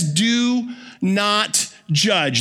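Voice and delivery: solemn, deliberate scripture quote; slow emphatic quote. Shown as a demonstration. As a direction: Do not judge, each word drawn out slowly. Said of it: "That's his big idea".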